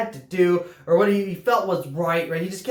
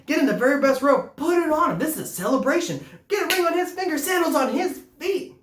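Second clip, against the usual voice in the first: excited father; 8 semitones higher than usual. Acted. Father ordering the celebration.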